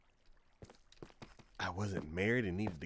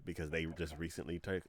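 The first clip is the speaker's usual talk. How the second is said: ordinary delivery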